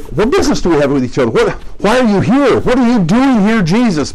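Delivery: a startled demon confronting Jesus; demon's alarmed question. Shown as a demonstration. A demon startled by Jesus, rising alarm. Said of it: "might be a way we'd say it".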